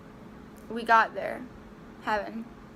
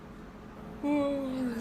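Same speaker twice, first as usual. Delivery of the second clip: a long, real yawn slipping out; 3 semitones higher, 5 dB quieter than usual. Real, not acted. Telling viewers about heaven, a real yawn. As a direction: yawn openly, mid-story. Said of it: "yawning on camera is so awkward".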